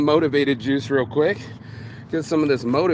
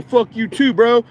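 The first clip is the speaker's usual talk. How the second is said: higher, louder imitation of arguing back; mock angry retort; 6 semitones higher, 5 dB louder than usual. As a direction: play someone firing back in an argument. Acting out someone arguing back.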